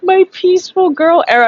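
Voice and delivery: laughing disbelief. Retold or imitated. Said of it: "I remember laughing in my dream".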